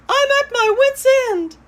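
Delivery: high, loud exasperated lady voice; shrill-pitched fussy lady, very loud; frantic Mrs. LaRue. Acted. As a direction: wail it out like a fretting owner at her wit's end. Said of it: "said Missus LaRue".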